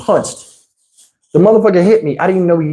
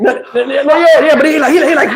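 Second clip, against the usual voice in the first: usual speaking voice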